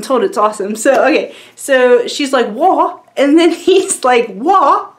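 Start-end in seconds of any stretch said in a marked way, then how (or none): 2.5-3.0 a puzzled British "What?" with a rising end; "What?" in an attempted British accent, higher and rising like a question; confused UK accent. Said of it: "they talk in U K accents, and hopefully I'm doing it right". 4.3-4.9 higher British "What?", mock-baffled; "What?" in an attempted British accent, pitched higher as the Doctor answers back. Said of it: "they talk in U K accents, and hopefully I'm doing it right".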